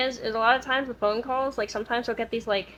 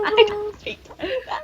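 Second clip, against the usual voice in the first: mock repeat; 8 semitones higher than usual. Repeating the joke.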